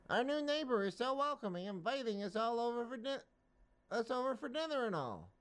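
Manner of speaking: high, thin bug voice, cheerful; a naive mosquito delighted by the invite; tiny mosquito voice. Acted.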